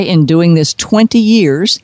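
ordinary talk

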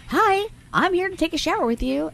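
high goofy voice for the pug; dog's voice. Retold or imitated. Voicing the pug that joined her shower, high and goofy. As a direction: speak for the bug-eyed dog, cheerful and high.

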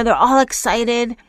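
usual speaking voice

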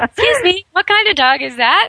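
curious stranger. Pretend. Playing a curious stranger in park.

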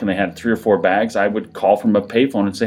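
ordinary talk

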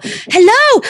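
bright high hello to the bird; pet greeting. Real, not acted. Host greets the parrot King O, high excited hello. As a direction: call hello up high to get the parrot talking.

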